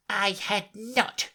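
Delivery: a touch of angry snap, drawn out; sharp, angry. A narrator's reading. Offended mouse denying it, understated anger. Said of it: "cried the mouse sharply and very angrily".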